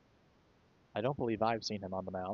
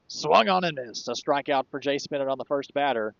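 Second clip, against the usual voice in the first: excited strikeout call; 3 semitones higher, 5 dB louder than usual. Real, not acted. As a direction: call the swing and miss with a burst of excitement.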